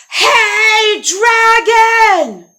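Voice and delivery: high, drawn-out holler; full shout. Acted. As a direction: bellow it right into the sleeper's ear. She yells into his ear.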